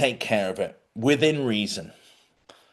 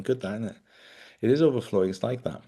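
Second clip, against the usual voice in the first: normal talking voice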